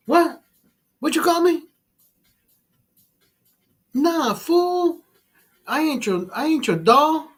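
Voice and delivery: high, indignant mock voice of a touchy customer; offended customer. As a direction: squeak out the outrage. Offended customer in retold video.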